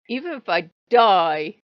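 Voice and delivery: loud stubborn siege vow; defiant declaration. Retold or imitated. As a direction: declare it from the battlements. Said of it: "I'm like".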